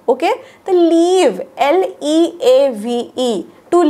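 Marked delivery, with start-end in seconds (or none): 0.7-1.4 "लीव्ह" with a long, stressed ee; long-vowel leave. Shown as a demonstration. Teacher contrasts leave with live, long-ee "लीव्ह". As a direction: hold the ee of "लीव्ह" before the v. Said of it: "that is leave".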